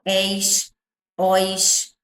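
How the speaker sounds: "és" and "ois" each as one syllable; isolated endings "és", then "ois", never split; unseparated diphthongs. Shown as a demonstration. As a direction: keep the vowels of "és" and "ois" glided together. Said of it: "eles não se separam quando nós falamos".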